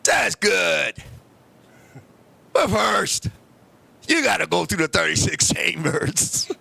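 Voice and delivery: put-on low, slow old kung fu master voice; deep, deliberate mock grandmaster challenge; old kung fu grandfather. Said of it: "He came right up to me and said".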